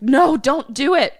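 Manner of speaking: high, loud pleading; mock panicked plea. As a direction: act out a desperate warning. Voicing the panicked father.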